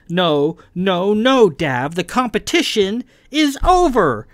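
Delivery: loud, high, insubordinate outburst; temper rising. A narrator's reading. Kai defying the captain, losing her temper. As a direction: build from firm refusal to heated high shout. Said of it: "getting her temper and her insubordination up".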